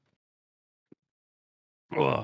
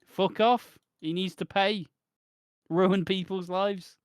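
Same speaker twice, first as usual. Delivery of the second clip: angry rant; 6 semitones higher, slower than usual. Real, not acted. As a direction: vent real anger at him. Ranting at the character.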